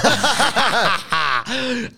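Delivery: loud high laugh breaking out; burst of laughter. Real, not acted. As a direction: laugh hard, can't get the words out. Laughing hard mid-story.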